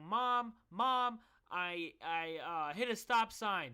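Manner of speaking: retold panicked phone yell. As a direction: yell it like a frantic kid confessing. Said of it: "I'm yelling".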